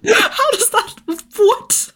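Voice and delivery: pitched high, thrown and confused; baffled disbelief. Retold or imitated. Salesperson stumped by a customer's odd question, baffled and high. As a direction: act caught off guard by a question you never rehearsed. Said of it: "And you're like".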